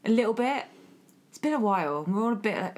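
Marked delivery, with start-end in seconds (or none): none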